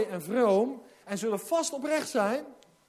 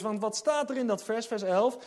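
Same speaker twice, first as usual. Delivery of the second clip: usual speaking voice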